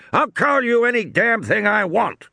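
high-pitched defiant yell; shouting. A narrator's reading. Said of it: "Muldoon shouted".

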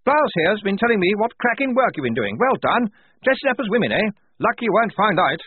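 high, loud hearty officer voice; blustering major. Acted. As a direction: boom out like a jolly old officer.

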